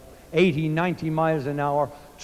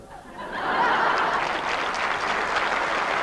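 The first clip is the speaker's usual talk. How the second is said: laugh. Real, not acted. Comedian laughs at own joke.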